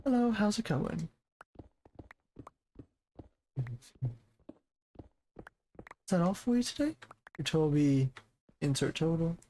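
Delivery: a lightly lifted polite cashier tone; customer service voice. Shown as a demonstration. Practising the clerk greeting for viewers, lightly voiced cashier. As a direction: rise up into friendly register. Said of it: "let me try it".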